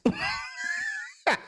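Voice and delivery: high screaming laugh; shrieking laugh. Real, not acted. Streamer cracking up at chat joke, shrieking.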